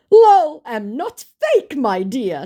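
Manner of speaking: high, loud, drawn-out scammer voice; mock the fake star's protest, slowly and loudly. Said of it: "said my fake".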